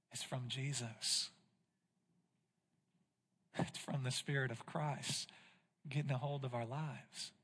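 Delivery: tender quiet conviction; hushed reverent preaching. Acted. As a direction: bring it right down for the answer. Soft earnest preaching after build.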